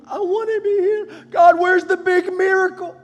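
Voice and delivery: very high, loud mock wail; pleading believer. Believer demanding a big miracle from God, wailing high and loud. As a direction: cry out in a high, demanding plea.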